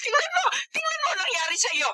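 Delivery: high, crying shout of a frantic mother; tearful scolding. Acted. Mother yells at her injured son, crying and shouting.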